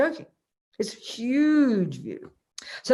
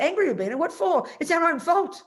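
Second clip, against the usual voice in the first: higher-pitched, lightly dismissive imitation; casual, amused retort; 8 semitones higher than usual. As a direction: imitate a friend shrugging it off, pitched up. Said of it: "he laughed really casually and said".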